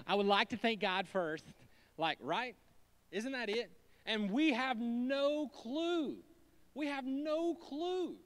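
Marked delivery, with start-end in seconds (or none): 0.1-1.5 louder, formal thank-you; mock award speech. Award winner thanking God, mock formal. 4.1-8.2 drawn out, higher pitch; slow emphatic refrain. Real, not acted. Preacher telling the church, slow emphatic. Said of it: "repeated emphatic refrain".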